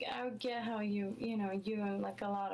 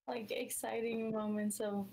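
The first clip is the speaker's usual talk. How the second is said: ordinary delivery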